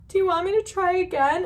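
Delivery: high, sheepish little ask; timid retold offer. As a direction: ask it small and high, embarrassed. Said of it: "And I was like".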